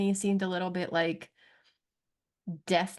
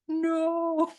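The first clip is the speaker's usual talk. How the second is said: high, drawn-out retold cry of denial; mock anguished wail; 9 semitones higher than usual. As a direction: wail it in hammed-up heartbreak. Retelling own heartbreak at the episode.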